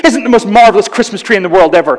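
loud, fast gush of wonder; over-the-top gushing. Retold or imitated. Phoebe's boyfriend gushes over a Christmas tree, loud and fast. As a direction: gush like an endlessly happy boyfriend.

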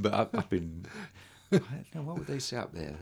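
usual speaking voice

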